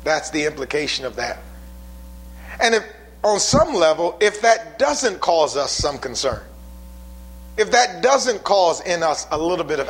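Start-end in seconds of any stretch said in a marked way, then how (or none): none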